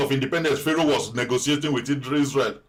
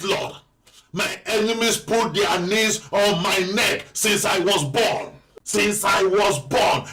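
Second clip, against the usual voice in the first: higher and slow, desperate crying out to God; pleading prayer; 4 semitones higher, slower than usual. Shown as a demonstration. Pastor models a desperate prayer for the congregation, higher and slow. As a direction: cry out to the Father as if you can't breathe. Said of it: "begin to tell the Father".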